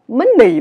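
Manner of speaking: "money" loud, first syllable up, second dropped; exaggerated Vietnamese tones. Shown as a demonstration. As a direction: lean hard on both tones of "money". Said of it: "vừa dấu sắc vừa dấu huyền". (đọc "money" dấu sắc rồi dấu huyền)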